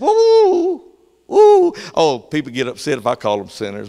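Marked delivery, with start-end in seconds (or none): 0.0-1.7 high, loud whooping cry; exultant whoop. Real, not acted. Preacher exulting after calling them sinners, high whoop. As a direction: let the spirit lift you.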